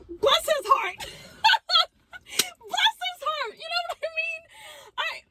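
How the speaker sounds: high laughing blessing; squealing laughter through the words. Real, not acted. Laughing fond mock blessing.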